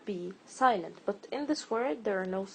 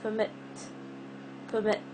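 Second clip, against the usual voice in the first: comMIT, MIT punched; stress on second syllable; faster than usual. Shown as a demonstration. Teacher quizzes learners on O or I, comMIT stressed on the second part. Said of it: "I'm stressing the second part".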